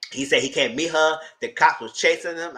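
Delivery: usual speaking voice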